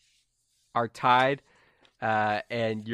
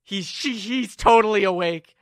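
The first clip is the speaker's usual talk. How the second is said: loud, high stammering alarm; freaking out; 10 semitones higher, 11 dB louder than usual. Acted. Henchman realizes Zippy is awake, panicked. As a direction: sputter the discovery in a panic. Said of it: "and they freak out".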